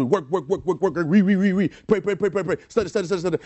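rapid-fire, relentless repetition; frantic striving. Acted. Preacher mimicking people working to get to love, frantic striving. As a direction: rattle off each repeated word.

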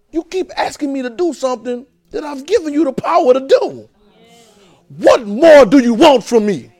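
God losing patience; exasperated God. Acted. God voiced answering minister.

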